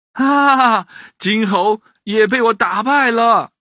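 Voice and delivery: put-on loud, high boy's victory cry; triumphant crowing. A narrator's reading. Boy has driven off the monkey, crowing. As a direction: crow over a beaten rival.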